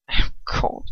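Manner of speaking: breathless gasped words; anguished gasp. Acted. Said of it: "he gasps out".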